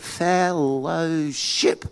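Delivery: very slow, stretched "fellowship"; sounding out. Acted. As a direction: read "fellowship" syllable by syllable, unfamiliar. Sounding out another new word.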